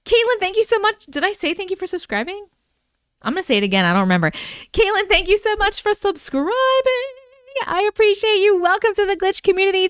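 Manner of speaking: high, bright gushing thanks; excited thanks. Real, not acted. Vlogger gushing thanks to Caitlin for subscribing, excited. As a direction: gush over a new subscriber.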